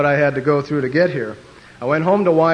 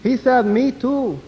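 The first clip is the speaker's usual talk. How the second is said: high, slow put-on Mexican accent; 9 semitones higher, slower than usual. First Mexican translating for stewardess, slow mock Mexican accent. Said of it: "the one Mexican said".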